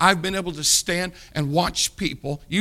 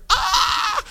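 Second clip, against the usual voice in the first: mock scream; 4 dB louder than usual. Sisters scream at Lazarus rising.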